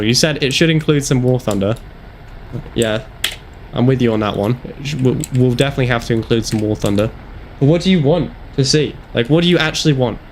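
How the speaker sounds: ordinary talk, nothing marked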